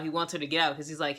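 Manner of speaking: ordinary talk, nothing marked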